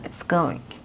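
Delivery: "It's going" breathed out quietly and unvoiced; casual muttered reply. Shown as a demonstration. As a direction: murmur "It's going" offhand. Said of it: "دقیقاً این ساختار همونه".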